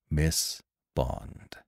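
low, soft and grave; hushed, commanding earnestness; low, intense feeling. A narrator's reading. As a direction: speak low, holding feeling in. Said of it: "low and vibrating with feeling".

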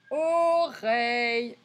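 Oreille very slow, both syllables held; drawn-out syllables. Shown as a demonstration. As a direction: stretch O, then REILLE. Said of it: "chercher si on entend".

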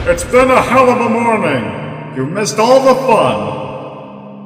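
excited. Acted. Henry telling a colleague about the wild morning, thrilled. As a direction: bubble over with excitement.

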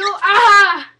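loud, high yelp; startled cry. Real, not acted. Bird interrupts, cry out.

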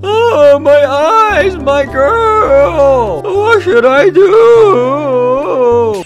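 wailing lion. Acted.

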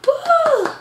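sudden high falsetto whoop. Real, not acted. Preacher whooping to rev up the congregation.

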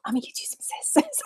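whispered line breaking into giggles; fighting back a laugh on the phrase; trying not to laugh. Acted. Reading a funny line to viewers, giggling. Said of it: "I can't even say it".

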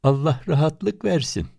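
mock them sweetly. A narrator's reading. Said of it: "Alaylı bir ses". (alaycı bir sesle)

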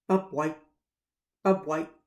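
loud bobwhite whistle-call. Acted. Narrator calling like the bobwhite bird, loud. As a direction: call out the bird's name.

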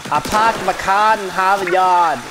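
hammed-up Boston accent. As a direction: drop every r, Boston-style, with relish. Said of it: "more Boston for ya".

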